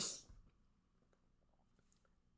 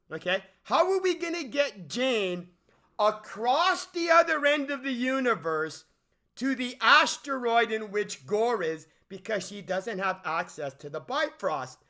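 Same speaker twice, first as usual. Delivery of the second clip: usual speaking voice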